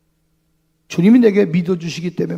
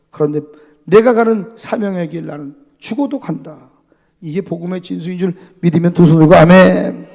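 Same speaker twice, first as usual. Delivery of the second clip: ordinary talk, nothing marked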